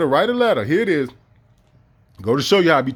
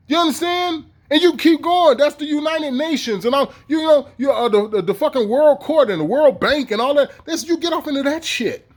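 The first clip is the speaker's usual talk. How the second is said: worked-up rant; 7 semitones higher than usual. Real, not acted. Worked-up rant about agencies.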